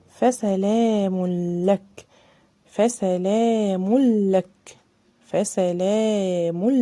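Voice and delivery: فسلام لك drawn out, tanween merged into the lam; slow recitation. Shown as a demonstration. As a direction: chant فسلام لك slowly, no ghunna. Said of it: "دخلنا على طول على اللام".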